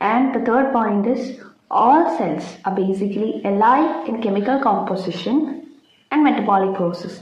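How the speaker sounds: slow, careful dictation pace. Acted. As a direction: spell it out for notes, slowly. Dictating cell theory point. (പതുക്കെ പറഞ്ഞുകൊടുക്കുന്ന രീതി)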